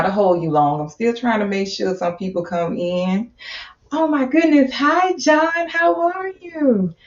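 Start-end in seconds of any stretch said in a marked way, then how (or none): 3.9-6.9 high, delighted hello; light up as a friend joins; excited greeting. Real, not acted. Host greeting a viewer who just joined the live, delighted.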